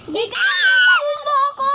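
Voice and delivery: loud call to a friend. Real, not acted. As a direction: shout the way over. Calling friend to the path.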